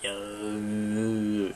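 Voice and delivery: ordinary delivery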